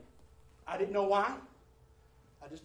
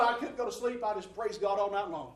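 ordinary talk, nothing marked

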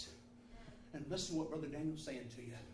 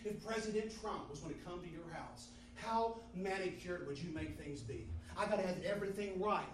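ordinary talk

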